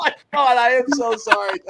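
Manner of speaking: high, giggling through it; real embarrassed laughter over the words; apologizing while still laughing; laughing apology. Real, not acted. Laughing apology after the gaffe.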